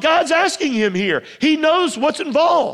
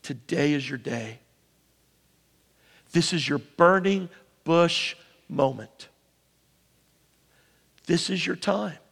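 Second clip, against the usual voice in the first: much softer, lower, intimate invitation; hushed altar call; 8 semitones lower, 6 dB quieter than usual. Real, not acted. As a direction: draw them in quietly to respond.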